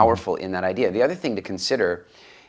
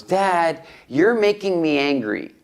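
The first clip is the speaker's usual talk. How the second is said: son complaining; 8 semitones higher, much slower than usual. Retold or imitated. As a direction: play the annoyed teenage son protesting to his dad. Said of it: "one day he goes".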